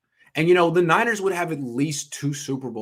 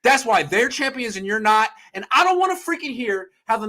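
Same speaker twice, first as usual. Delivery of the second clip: angry rant; 6 semitones higher, 4 dB louder than usual. Real, not acted. Ranting at the Niners.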